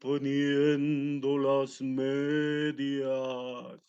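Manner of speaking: slow, drawn-out wolf. Acted. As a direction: stretch every word like a lazy wolf. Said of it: "dijo el lobo feroz".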